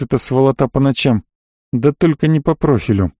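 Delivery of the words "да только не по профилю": usual speaking voice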